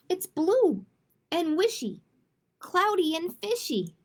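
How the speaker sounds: lively rhyming read-aloud. A narrator's reading. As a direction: read each rhyme like a game for kids. Reads a rhyming picture book.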